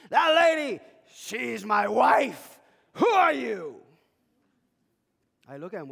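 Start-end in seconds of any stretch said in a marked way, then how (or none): 0.1-3.8 put-on loud, high, slow drunken yell; drunk's jealous shout. Jealous drunk husband confronting the pastor, shouting. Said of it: "and he says".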